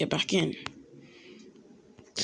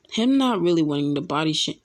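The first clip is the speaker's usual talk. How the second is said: ordinary delivery, nothing marked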